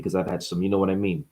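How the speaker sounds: a hint of a Jersey mob guy; low Jersey gangster mumble; New Jersey mobster. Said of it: "speak like a frickin' guy from Jersey".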